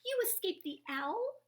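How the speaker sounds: high, loud joyful cry; excited cry. A narrator's reading. Stellaluna cries out to her found mother, overjoyed. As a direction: cry out in happy disbelief. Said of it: "cried Stellaluna".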